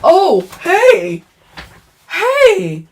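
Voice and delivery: very high, loud mock-innocent voice; hammed-up falsetto discovery, drawn out; imitated sheepish inmate. Inmate pretending to find the missing spring, high mock innocence. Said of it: "He was like this".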